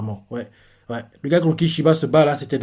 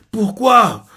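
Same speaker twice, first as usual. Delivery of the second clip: despairing cry; 7 semitones higher, 5 dB louder than usual. Retold or imitated. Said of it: "Il fait".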